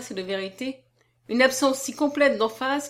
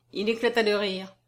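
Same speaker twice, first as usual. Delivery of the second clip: plain reading voice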